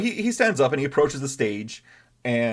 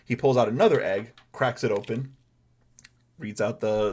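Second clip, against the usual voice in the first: normal talking voice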